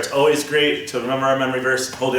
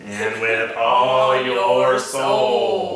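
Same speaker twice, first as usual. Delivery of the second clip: very slow, mechanical alien drone; robot alien voice; much slower than usual. Pretend. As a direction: chant it like a robot from space. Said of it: "how about we do it in a robot alien voice".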